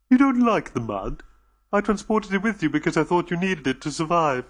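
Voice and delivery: anxious, gentle alien voice; lightly voiced anxious alien reassuring the visitors; nervous alien host. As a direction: soothe the frightened guests, a little on edge. Dodo puzzled.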